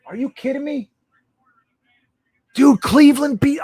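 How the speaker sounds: shocked outburst. Real, not acted. Host reacting live to Cleveland's win, shocked shout. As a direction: blurt it out in disbelief.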